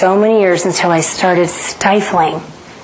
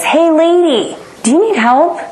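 construction worker calling; 9 semitones higher than usual. Retold or imitated. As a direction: call out like a worker spotting a barefoot lady. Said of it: "one of them says".

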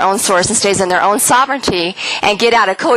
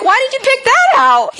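much higher, fast bewildered question; confused coma patient. Retold or imitated. Patient mistaking hospital room for home, bewildered. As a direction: sound lost and baffled. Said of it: "And I'm like".

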